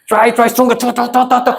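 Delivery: high, rapid excited goading; egging on. Pretend. Teacher daring a volunteer to punch harder, excited goading.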